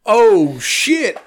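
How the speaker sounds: high, dismayed realization; retold alarm. Storyteller realizes he hurt his coworker, high dismayed exclamation. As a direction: act out the oh-no moment. Acts out sudden alarm at injury.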